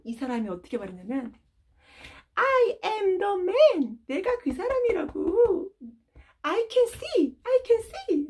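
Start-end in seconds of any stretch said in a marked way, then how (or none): 2.3-4.0 high, loud, slow proud announcement; joyful declaration. Acted. Healed man telling doubting neighbors, proud and joyful. 4.1-8.3 high, laughing cries of joy. Acted. Blind man overjoyed before his neighbors, laughing.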